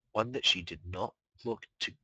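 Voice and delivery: plain reading voice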